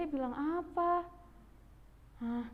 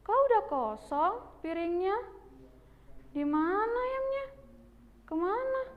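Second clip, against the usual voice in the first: high-pitched, startled questions rising upward; puzzled surprise; 7 semitones higher than usual. Acted. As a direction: play an older sibling baffled by an empty plate. Finding the plate empty.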